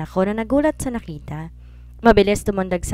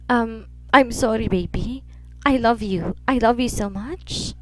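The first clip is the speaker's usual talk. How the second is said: tearful apology; 3 semitones higher, slower than usual. Acted. Mother crying, apologizing to son.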